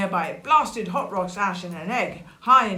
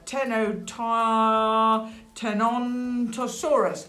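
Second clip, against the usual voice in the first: slow, higher sounding-out of "Tenontosaurus"; 3 semitones higher, much slower than usual. Shown as a demonstration. Storyteller working out the dinosaur's name, "Tenontosaurus" slowly sounded out. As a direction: stretch "Tenontosaurus" out carefully.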